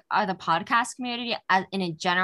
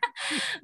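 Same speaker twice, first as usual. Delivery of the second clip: short high-pitched giggle; a quick bright laugh; 4 dB quieter than usual. Real, not acted. Laughing at own remark.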